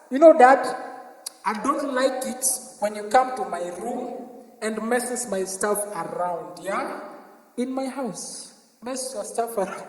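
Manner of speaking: low, soft, prim lecture; soft lecturing kid. Retold or imitated. Kid lecturing dad at home.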